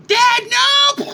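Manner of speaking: put-on very high, loud child's cry; terrified scream. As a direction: scream for your father in terror. Child screams before being killed.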